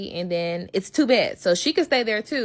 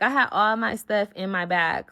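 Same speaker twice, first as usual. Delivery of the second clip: ordinary delivery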